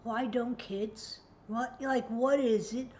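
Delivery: usual speaking voice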